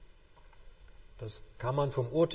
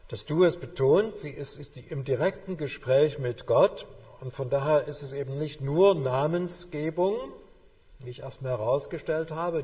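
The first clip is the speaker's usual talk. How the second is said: usual speaking voice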